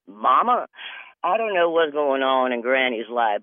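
ordinary delivery

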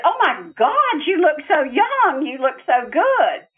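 high, bright-eyed exclaiming; astonished delight. Retold or imitated. Daughter amazed her dead father looks young, high astonished delight. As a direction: gush at how young he looks. Retold reunion with late father.

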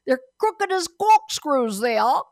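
high, loud mock-Cockney accent; Cockney taxi driver. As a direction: call it out in a rough attempt at Cockney. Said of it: "this Cockney taxi driver said to us".

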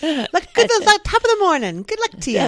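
mock Irish, tossing out stock Irish phrases; fake Irish accent. Hosts joking on their podcast, in a fake Irish accent. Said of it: "my fake Irish accent".

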